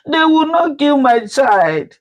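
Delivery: put-on a mother screaming for her hurt child; anguished scream. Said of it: "she screamed".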